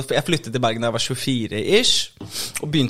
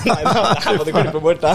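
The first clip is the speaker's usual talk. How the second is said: mock Israel; 5 semitones higher, 3 dB louder than usual. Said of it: "Så Israel bare".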